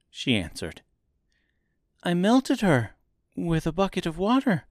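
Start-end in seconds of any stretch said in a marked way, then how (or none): 2.0-4.6 put-on high-pitched girl voice; girl character. A narrator's reading. Girl holding Oz to his promise, high girl voice. Narrator voices Dorothy answering.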